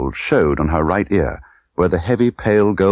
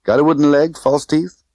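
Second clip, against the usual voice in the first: teasing Tiffany; 9 semitones higher, 4 dB louder than usual. Acted.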